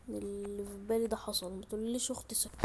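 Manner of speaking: worried whisper. Real, not acted. Player dreading that sister is awake, worried whisper. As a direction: whisper it, dreading who may hear. Fears sleeping sister has woken.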